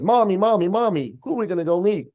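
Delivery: put-on high, eager child calling mommy; excited little boy. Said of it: "how little boys get excited".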